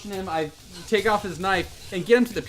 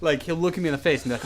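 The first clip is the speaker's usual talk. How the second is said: ordinary talk, nothing marked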